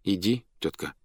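soft, tender urging; quietly. A narrator's reading. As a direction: say it gently under the breath. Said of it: "сказал он тихо". (тихо и ласково)

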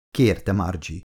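ordinary delivery

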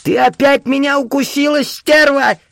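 very high, outraged yelp; old man's hurt, high-pitched cry; pained, amazed old man. A narrator's reading. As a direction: yell in pain and disbelief. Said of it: "слышались боль, изумление".

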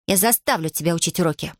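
plain reading voice

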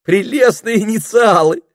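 high, loud, gushing exclamation; delighted. A narrator's reading. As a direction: praise it with rapture. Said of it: "Пенелопа была в восторге".

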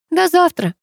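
half-hearted high-voiced farewell; mildly limp group response; listless reply. A narrator's reading. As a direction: answer with little enthusiasm. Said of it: "вяло отозвались девочки".

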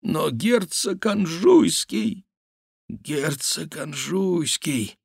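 put-on high, slow, drawn-out old man's dismay; fearful babbling. A narrator's reading. Old man dreads the prince's anger, babbling fearfully. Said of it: "лепетал старик".

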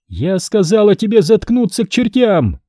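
high, furious outburst at moderate volume; acted scream. A narrator's reading. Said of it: "закричала женщина".

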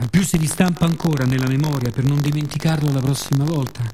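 usual speaking voice

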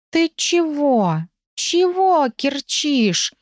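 high, very slow bewildered kitty voice; flustered cat. Acted. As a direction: drawl it out high and confused. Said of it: "смутился котик".

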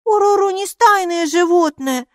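high, loud indignant squeal; shrilly insistent small creature; protesting. A narrator's reading. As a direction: object hotly in a little high voice. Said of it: "запротестовала Майки".

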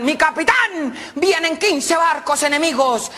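high, drawn-out lookout's cry; acted warning yell to the captain; shouted alarm. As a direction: call it out across a ship. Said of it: "le gritaron al capitán".

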